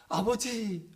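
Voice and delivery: loud, pleading cry of father; a son's loud, anguished cry. Shown as a demonstration. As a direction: call out to him as if weeping. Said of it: "이 감정 없이 읽으면 안 되는 거예요".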